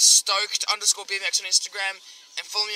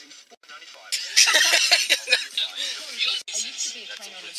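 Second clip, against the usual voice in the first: long, real burst of laughter; 3 semitones higher than usual. Real, not acted. Cracking up after calling mates savages, long laugh.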